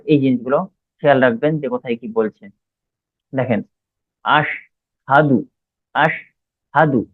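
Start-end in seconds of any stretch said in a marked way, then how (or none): 4.2-5.4 আশহাদু very slowly, "ash", pause, "hadu"; pronunciation demo. Shown as a demonstration. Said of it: "আলিফ আর শিনকে একসঙ্গে করে". 5.9-7.0 আশহাদু very slowly, "ash", pause, "hadu"; pronunciation demo. Shown as a demonstration. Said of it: "আলিফ আর শিনকে একসঙ্গে করে".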